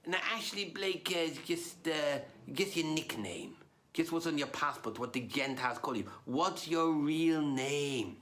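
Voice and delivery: put-on rabbi explaining in a Yiddish-English accent, drawing out the final question; hesitant rabbi working up to a slow question; Yinglish-speaking rabbi. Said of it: "then he said to me".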